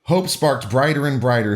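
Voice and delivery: usual speaking voice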